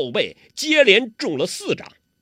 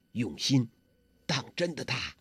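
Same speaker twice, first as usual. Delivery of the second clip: softly urging; 2 semitones lower, 6 dB quieter than usual. Acted. Huang Rong urging Guo Jing to fight seriously, softly. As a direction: say it softly so only he hears. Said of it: "黄蓉小声地说".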